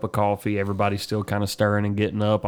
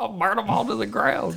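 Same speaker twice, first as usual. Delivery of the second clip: mock threat; 12 semitones higher than usual.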